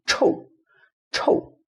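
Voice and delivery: "chou" with a short falling tone; "chou" in Mandarin tone four; fourth tone. Shown as a demonstration. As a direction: drop "chou" sharply from the top. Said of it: "we can make fourth tone".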